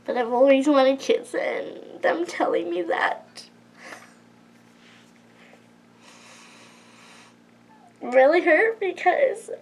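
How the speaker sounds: real tears, voice pushed high and breaking; fighting back tears while telling it; crying. Real, not acted. Vlogger tells viewers she always wanted kids, crying. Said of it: "sorry about crying".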